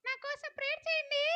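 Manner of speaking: usual speaking voice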